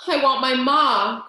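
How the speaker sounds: loud, pleading cry for her mother. A narrator's reading. Mig cries to the waiting world, loud and pleading. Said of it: "cried Mig".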